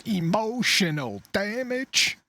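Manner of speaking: mock Chinese accent, high and slow; imitated Chinese gentleman. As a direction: stretch the catchphrase out in a parodied Chinese accent. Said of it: "the Chinese gentleman that says".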